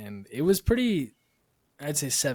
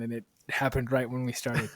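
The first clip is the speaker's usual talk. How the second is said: ordinary talk, nothing marked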